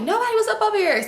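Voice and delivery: high, quick mock denial; hurried denial. Cousin denies anyone was over, high and hurried.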